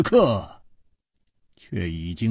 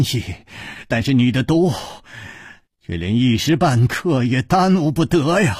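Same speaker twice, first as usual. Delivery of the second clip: angry rebuke; 4 semitones higher than usual. Acted. Chu Liuxiang angrily scolds Hu Tiehua about the poison, angry. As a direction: scold a reckless friend in anger. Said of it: "楚留香怒道".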